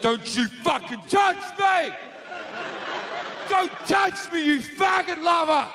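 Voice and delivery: God lashing out at those who touch him; furious God. Acted. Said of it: "He's like".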